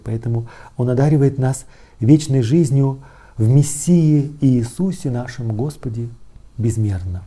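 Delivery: usual speaking voice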